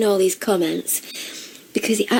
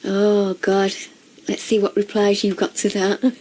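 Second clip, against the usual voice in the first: usual speaking voice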